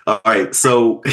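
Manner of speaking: normal talking voice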